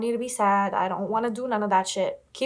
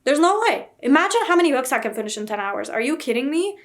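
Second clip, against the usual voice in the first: high, loud, incredulous outburst; loud disbelief; 4 semitones higher, 4 dB louder than usual. Real, not acted. Vlogger imagining a ten-hour reading timer, loud disbelief. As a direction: exclaim in playful outrage, pitch jumping up.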